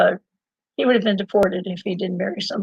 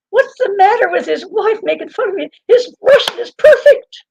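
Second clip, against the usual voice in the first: high-pitched, louder-ending imitation of an indignant friend; mock indignation; 10 semitones higher, 5 dB louder than usual. Friend defends Lee's Russian against wife's mockery, mock indignation. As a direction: protest in a much higher, hammed-up voice.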